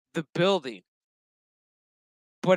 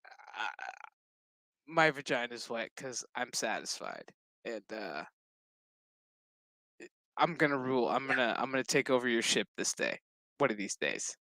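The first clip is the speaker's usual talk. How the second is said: smug Seven; 5 semitones lower, 10 dB quieter than usual. Retold or imitated. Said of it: "Seven's just like".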